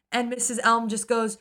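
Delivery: normal talking voice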